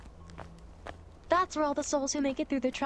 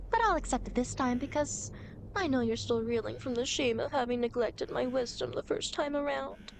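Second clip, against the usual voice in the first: normal talking voice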